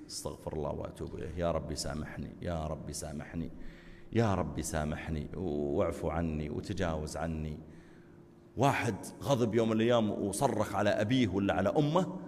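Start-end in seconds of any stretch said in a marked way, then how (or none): 0.1-7.6 low, soft murmured plea for forgiveness; hushed repentance. Acted. A sinner quietly begs God for forgiveness, hushed. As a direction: whisper your repentance to God.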